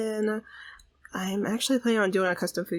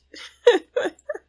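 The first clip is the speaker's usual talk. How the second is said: high squealing laugh; 12 semitones higher, 6 dB louder than usual. Real, not acted. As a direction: let out a high giggle squeal. Laughing at Kira.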